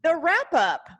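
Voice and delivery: high and loud, bursting with excitement; joyful climax of the story; excited. Real, not acted. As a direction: let the thrill spill out. Excited telling the big finish.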